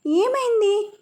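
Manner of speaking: high, urgent worried questions; alarmed caller comforting a crying girl; worried elder on the phone to a crying girl; anxious. Acted. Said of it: "అటు నుంచి కంగారుగా".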